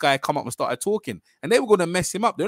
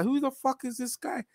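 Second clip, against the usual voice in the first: imitating incredulity in a higher, softer voice; retold indignant question; 9 semitones higher, 6 dB quieter than usual. Retelling the boxer's reaction to being disrespected, indignant. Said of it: "And Wilder's like".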